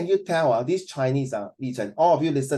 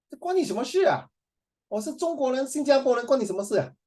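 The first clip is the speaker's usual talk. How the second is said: high-pitched, offended comeback; mock indignation; 9 semitones higher than usual. Teacher plays a lady snapping at a nosy question, mock indignant. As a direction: act affronted, voice pitched well up.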